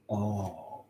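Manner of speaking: very soft, low "oh"; hushed, deflated reaction; stunned realization. Retold or imitated. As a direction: let it out quietly as it sinks in. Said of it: "Like that".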